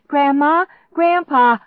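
high, loud frantic call for help; crying out. A narrator's reading. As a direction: call desperately through the house. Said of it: "I cried out".

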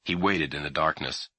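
usual speaking voice

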